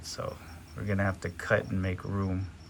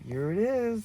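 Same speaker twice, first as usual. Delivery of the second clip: high, eager reveal; excited; 11 semitones higher than usual. Real, not acted. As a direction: announce the big moment. Excited reveal, pitch jumps.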